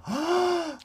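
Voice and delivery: sharp high gasp; surprised gasp. Real, not acted. Surprised by group auction.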